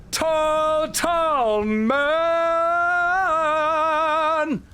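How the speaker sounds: drawn-out high announcer shout; stretched-out hype shout for a gifted sub. Real, not acted. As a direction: call the name like a ring announcer.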